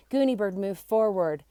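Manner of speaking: ordinary delivery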